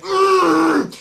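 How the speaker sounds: loud, high strained "mmm"; frustrated groan. Retold or imitated. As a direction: imitate a gamer's straining groan. Frustrated noise on being killed.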